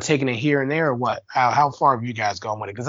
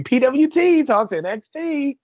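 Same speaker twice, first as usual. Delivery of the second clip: high, loud cartoony announcer call; fighting game announcer; 12 semitones higher, 3 dB louder, slower than usual. Shown as a demonstration. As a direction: hype the name like a fighting game intro. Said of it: "say it in that cartoony way every time".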